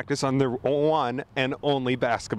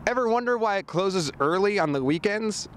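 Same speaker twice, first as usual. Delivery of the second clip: usual speaking voice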